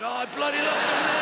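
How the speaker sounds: high, loud mock craving cry; craving outburst. Mock craving outburst, you at the shop. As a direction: whine like a desperate lilt addict. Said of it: "You're like".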